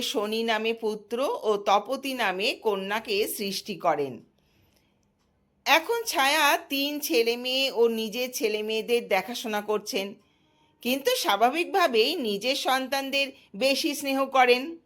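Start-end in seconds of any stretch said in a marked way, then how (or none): none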